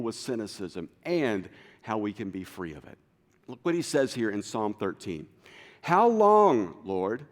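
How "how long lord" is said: loud, high pleading cry; desperate plea shouted upward; urgent cry. Acted. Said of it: "You sense the urgency here?".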